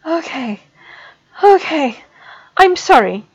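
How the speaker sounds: gasping apology; tearful Rigel. A narrator's reading. Student in panic apologizes to professor, tearfully.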